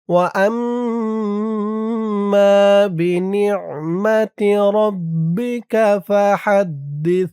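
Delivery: slow, drawn-out chanted recitation; Quran recitation. Shown as a demonstration. Teacher reciting the verse to learners, slow and drawn out. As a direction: stretch each vowel as in tajweed.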